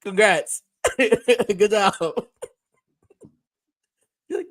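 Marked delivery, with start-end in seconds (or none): 0.0-1.9 higher-pitched, laughing fake praise; retold applause through a laugh; mock congratulations. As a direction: humor them with amused congratulations. Mock congratulations for weak impression.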